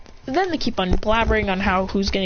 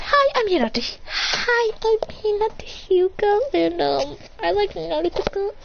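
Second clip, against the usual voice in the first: put-on high, hesitant girl voice; shy, slow kid-voice introduction; Hinata; 9 semitones higher than usual. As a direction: introduce yourself in a tiny high voice. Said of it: "she says".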